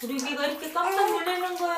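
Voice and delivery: loud cooing. Real, not acted. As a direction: soothe loudly over the crying. 우는 아기를 큰 소리로 달램. (우는 아기에게 큰 소리로 달래기)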